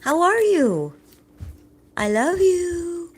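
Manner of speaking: high, loud, drawn-out cooing; coaxing pet talk. Real, not acted. Owner seeing if he'll talk back, high coaxing. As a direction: stretch it out singsong for a pet. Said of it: "Let's see if we'll do it".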